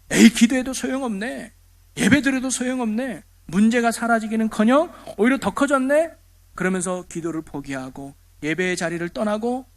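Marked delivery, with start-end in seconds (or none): 0.1-6.1 higher-pitched dismissive griping; faithless complainer. Acted. Unbeliever complaining that prayer is useless, raised griping. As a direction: voice a doubter giving up, exasperated. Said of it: "믿음 없는 사람이 이렇게 말할".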